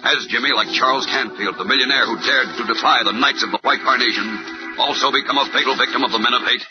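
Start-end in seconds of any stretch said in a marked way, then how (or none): 2.6-6.6 rising, excited serial sign-off; old radio announcer. Acted. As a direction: hype tomorrow's episode to kids. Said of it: "earlier reader: old radio announcer".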